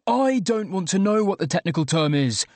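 high, moderately forceful yelling; exasperated yell. A narrator's reading. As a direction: protest in exasperation, pitch pushed up. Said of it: "I yelled".

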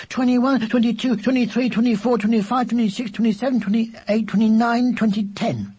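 high, rattled-off counting; sudden gabbled high-pitched count; loud, very fast. A narrator's reading. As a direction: race through numbers without a breath. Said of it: "loudly and very fast indeed".

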